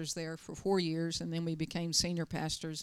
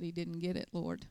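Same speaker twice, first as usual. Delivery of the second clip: quiet lament; 2 semitones lower, 6 dB quieter than usual. Retold or imitated. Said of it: "bowed his head and he said".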